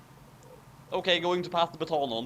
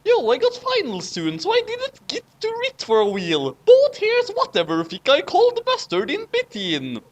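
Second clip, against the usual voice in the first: a touch higher, lightly voiced hero; rereading the line; 11 semitones higher, 5 dB louder than usual. Reader retakes the hero's line after a correction, lightly voiced. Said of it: "first error of the night".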